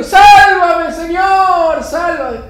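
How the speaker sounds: very high, loud cry for rescue; hammed-up panicked begging; desperate pleading shout. Climber begging the Lord to save him, desperate shout.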